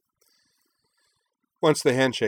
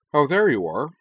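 lively, raised greeting; bright greeting; 7 semitones higher, 8 dB louder than usual. Acted. Utna greeting her fiancé, bright and glad. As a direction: call out glad to see him.

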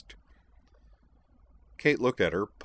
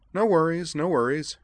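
high, loud reassurance; insistent; 10 semitones higher, 7 dB louder than usual. A narrator's reading. Edward answers worries about another night's lodging, insistent. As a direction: brush the concern aside emphatically. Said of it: "Edward insisted".

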